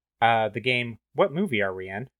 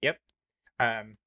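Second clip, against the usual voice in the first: usual speaking voice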